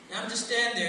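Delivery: normal talking voice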